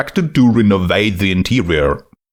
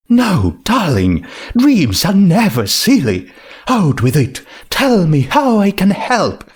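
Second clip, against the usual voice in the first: high, encouraging and grand; Rarity voice; 8 semitones higher than usual. Acted. Rarity reassuring the ghost in a high voice. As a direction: coax her dream out, lifted high.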